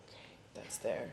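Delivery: very low, near-whispered note to self; hushed private thought. Retold or imitated. Actress spotting a hole in the stage, hushed inner voice. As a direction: take it in under your breath.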